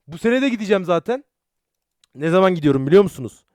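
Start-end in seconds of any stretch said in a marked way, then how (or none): none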